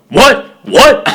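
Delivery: high, loud mock "What?"; offended challenge. Retells offended freshman calling out.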